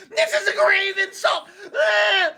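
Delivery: high, loud offended squeal; shrieking indignation, half laughing; outraged J D Vance. Retold or imitated. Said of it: "J D Vance was like".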